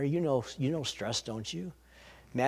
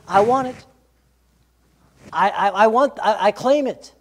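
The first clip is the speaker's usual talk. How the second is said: louder, insistent claim; 4 semitones higher, 8 dB louder than usual. Pretend. Believer claiming God's promise, louder and insistent. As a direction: declare it with faith and force. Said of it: "you just gotta say".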